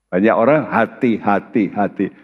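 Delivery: ordinary talk, nothing marked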